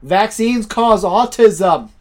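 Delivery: put-on high, loud, drawn-out American accent; mock American call. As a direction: bellow it slowly like a caricature American. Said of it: "that's a very American thing".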